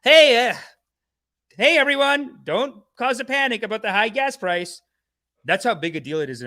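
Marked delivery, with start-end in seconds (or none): 0.0-4.8 higher, louder fake-cheery host; hammed-up propaganda announcer; mock announcement. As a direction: parody a government PSA. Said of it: "does government propaganda announcements saying".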